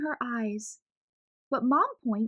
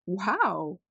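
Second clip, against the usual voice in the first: ordinary delivery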